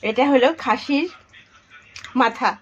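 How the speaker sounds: high, loud, coaxing quiz voice; talking to a toddler. Acted. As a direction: ask it like teasing a small child. Showing a goat's head, quizzing someone. (শিশুকে প্রশ্ন করার মতো উঁচু গলায়)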